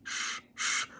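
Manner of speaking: soft unvoiced steam hiss. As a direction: hiss out like an engine. Steam sounds.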